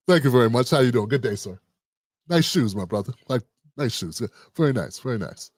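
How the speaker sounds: low, mild-mannered, over-polite gentleman; Ryan Coogler, imagined polite. Retold or imitated. Imagined customer greets people at the bank, politely. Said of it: "We all thought he went in there like that".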